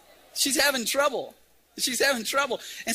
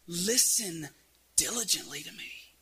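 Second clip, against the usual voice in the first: hushed quotation; 3 semitones lower, 5 dB quieter than usual. Acted. Isaiah quoted softly as intimate call.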